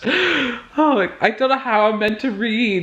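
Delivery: very high oh, staying high through the line; too thrilled to focus, voice shooting up; overexcited squeal. Real, not acted. Subscriber count nearly at ten K.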